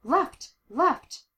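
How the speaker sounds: high, urgent shout; shouted directions. A narrator's reading. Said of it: "Darcy shouted in her ear".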